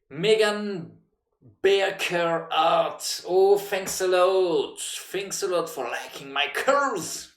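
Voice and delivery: high, loud, slow put-on voice; mock girly voice. Streamer mocking a chat viewer, in a high put-on voice. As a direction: camp it up in a high sing-song.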